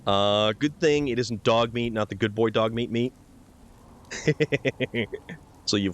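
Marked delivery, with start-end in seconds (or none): none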